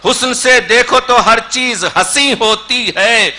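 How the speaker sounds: rhythmic, lilting declamation of verse; Urdu poetry recitation. Acted. Poet presents a poem on absolute beauty, declaimed. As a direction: recite with building emphasis on each refrain.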